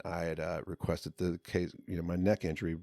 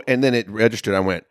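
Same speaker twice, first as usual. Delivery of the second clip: ordinary talk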